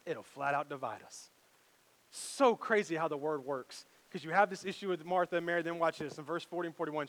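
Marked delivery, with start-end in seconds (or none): none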